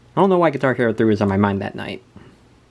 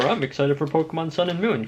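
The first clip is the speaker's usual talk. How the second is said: excited; 7 semitones higher, 3 dB quieter than usual. Retold or imitated. Reading excited dream journal entries.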